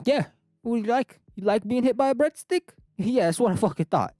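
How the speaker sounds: high, loud mock-Italian taunt; triumphant Italian caricature, pitched high; Italian accent. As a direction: gloat in a big comic Italian accent. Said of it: "why I speak like this".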